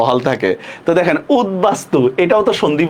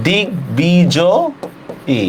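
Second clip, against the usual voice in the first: দিগ্বিজয়ী stretched very slowly, syllable by syllable; teacher slowly drawing out দিগ্বিজয়ী for the class; drawn out slowly; much slower than usual. Shown as a demonstration. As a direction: spell দিগ্বিজয়ী out long and slow for the class. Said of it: "এরকম শব্দটা".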